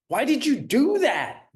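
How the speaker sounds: high, loud accusing demand; accusing question. Shown as a demonstration. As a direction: fire it like a scolding parent. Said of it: "there's a difference between".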